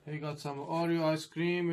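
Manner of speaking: ordinary delivery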